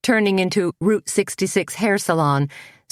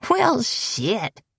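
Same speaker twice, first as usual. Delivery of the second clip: a lazy drawled curse, pitched higher; 8 semitones higher than usual. A narrator's reading. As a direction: stretch the words out slow and easy. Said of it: "in a kind of drawl".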